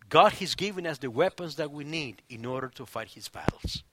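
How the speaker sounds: ordinary talk, nothing marked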